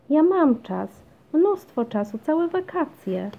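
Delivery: high, loud, eager insistence; pleading child. A narrator's reading. Basia argues she has time for a dog, pleading. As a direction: plead like a child, stretching the last words.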